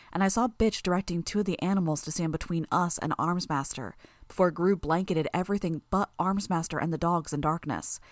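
usual speaking voice

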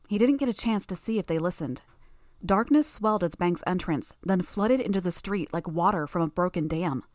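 usual speaking voice

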